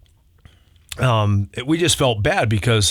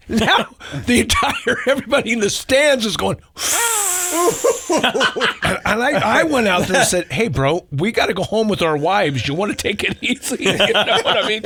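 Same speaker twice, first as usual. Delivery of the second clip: much higher, words breaking into laughter; laughing while talking; 11 semitones higher than usual. Real, not acted. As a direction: tell it still cracking up. Laughing while retelling the punchline.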